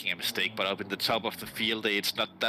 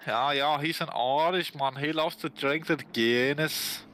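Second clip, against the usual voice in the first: slow, lightly put-on Irish accent, higher; mock Irish accent; 4 semitones higher, slower than usual. Mocking the Irish driver in a put-on Irish accent. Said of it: "he doesn't sound like he had a stroke".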